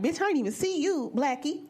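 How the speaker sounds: put-on high, loud taunting boy; imagined boy's insult. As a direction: sneer it at her like a mean kid. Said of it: "He probably like".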